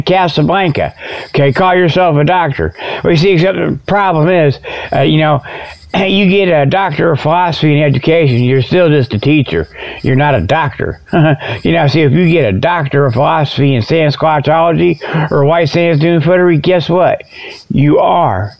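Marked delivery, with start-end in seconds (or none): none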